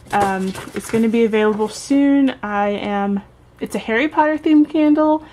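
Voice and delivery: ordinary talk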